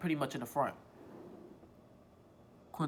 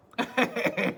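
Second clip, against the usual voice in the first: a short high laugh; burst of laughter; 10 semitones higher, 8 dB louder than usual. Real, not acted. Laughing at being first off the bus, a short high laugh. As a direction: laugh at the memory. Laughs at her own story.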